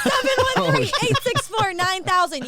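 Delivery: loud, high shout through laughter; laughing shout. Real, not acted. Reading the law firm's number for his brother, laughing shout. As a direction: belt out the phone number, half laughing. Phone number read out, joking with co-hosts.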